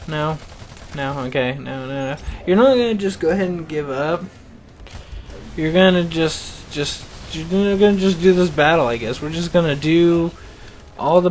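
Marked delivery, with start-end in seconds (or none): none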